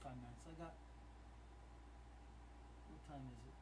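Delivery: low, soft, absorbed murmur; subdued thinking aloud. Real, not acted. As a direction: think aloud quietly while checking. Checking numbers, thinking to himself.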